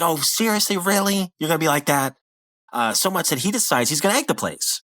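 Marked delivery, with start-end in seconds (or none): 0.0-2.1 higher-pitched, acted indignation; mock aggravation. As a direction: imitate a peeved cartoon character. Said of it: "this really aggravates Rigby".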